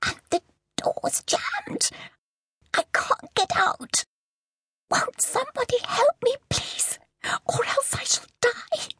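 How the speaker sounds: very high, slow, breaking cries for help; shrill terrified pleading. Acted. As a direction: beg for rescue while trapped. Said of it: "Now she was screaming".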